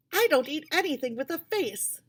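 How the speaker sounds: put-on a prim girl announcing her diet; girl's voice. A narrator's reading. Japanese girl's voice, much higher.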